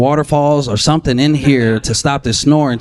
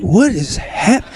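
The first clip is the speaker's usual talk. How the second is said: startled; 8 semitones higher, much slower than usual. Retold or imitated. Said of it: "I'm like".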